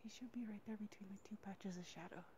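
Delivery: whispering while framing the deer; soft, barely voiced and quick; hushed whisper. Real, not acted. Hiker whispering to viewers while filming the buck, hushed whisper.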